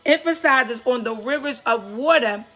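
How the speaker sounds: impassioned exhortation, lifted above conversational talk; real preaching. Real, not acted. Preacher urges listeners not to miss their season, preaching. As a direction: press each point home in short bursts, with pauses to let it land.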